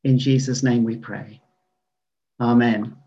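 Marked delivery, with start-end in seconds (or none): none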